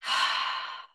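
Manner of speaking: long breathy sigh out through the mouth; audible sigh. Shown as a demonstration. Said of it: "exhale through the mouth".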